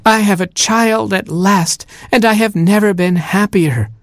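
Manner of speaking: tears of joy. A narrator's reading. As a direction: sob it out through happy tears. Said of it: "she wept tears of joy".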